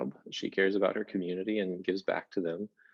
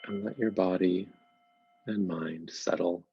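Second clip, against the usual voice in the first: guided meditation. Acted. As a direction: gently guide listeners into settling, leaving space between phrases. Begins leading a guided meditation.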